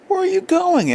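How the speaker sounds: high sleepy wife voice; wife's question. A narrator's reading. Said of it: "asked his wife".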